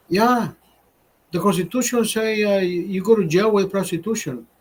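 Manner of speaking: high mock Mexican accent, broken English; imitating Mexican accent. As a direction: lampoon the coworker in a thick Mexican accent. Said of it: "sounds like a Mexican when he talks".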